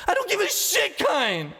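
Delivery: very high, loud furious bark; enraged barked retort. Acted. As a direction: snap it at him. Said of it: "the mechanist barked".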